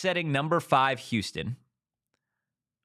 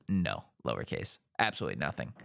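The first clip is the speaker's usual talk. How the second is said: much lower and softer; low, understated readout; 10 semitones lower, 6 dB quieter than usual. Retold or imitated. Reading a viewer's curt answer from the chat, low and understated. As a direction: read it out small, dropping the voice.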